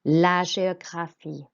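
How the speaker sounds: GÉOGRAPHIE, é said as "ay"; measured French model of GÉOGRAPHIE with "zh"; soft French G. Shown as a demonstration. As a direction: say GÉOGRAPHIE as "zhay-o-gra-FEE". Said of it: "E accent makes an A sound".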